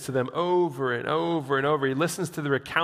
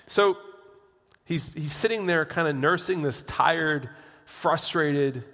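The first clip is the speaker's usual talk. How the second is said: usual speaking voice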